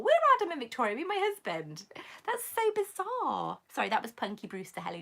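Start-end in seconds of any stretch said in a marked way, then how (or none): 0.0-3.6 excited, high-pitched disbelief; amazed disbelief. Real, not acted. Streamer tells viewer she and husband share those names, amazed. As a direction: swoop up in real astonishment.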